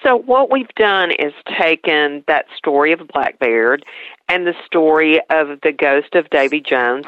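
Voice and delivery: usual speaking voice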